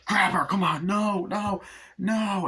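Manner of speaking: high, urgent mock panic; high-pitched acted alarm; frantic commands. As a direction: act out a frantic struggle to seize someone. Acted struggle to seize her.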